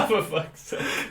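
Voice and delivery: high, slow, laughing through it; cracking up in disbelief; laughing exasperation. Real, not acted. Laughing as his friend's real name slips out.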